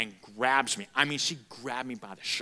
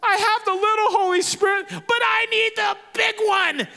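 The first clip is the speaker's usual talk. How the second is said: imitating a sobbing girl's cry; sobbing plea; 8 dB louder than usual. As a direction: beg through tears for more. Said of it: "She goes".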